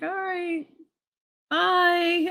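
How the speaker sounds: high, drawn-out sheepish bye; awkward goodbye. Retold or imitated. Sneaking out past his mother, awkward and high. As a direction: edge out with a squeaky little farewell.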